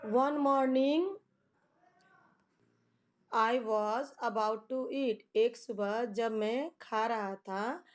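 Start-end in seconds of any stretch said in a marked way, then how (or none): 0.1-5.2 slow, deliberate read-aloud of an English poem line. Shown as a demonstration. Teacher reads a poem line to students, very slow and measured. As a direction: read each word slowly for the class. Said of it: "teacher reads poem lines slowly".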